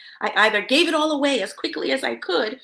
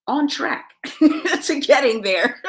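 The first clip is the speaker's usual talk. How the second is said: laughing; 3 semitones higher, 4 dB louder, slower than usual. Real, not acted. Laughs about the salary climbing.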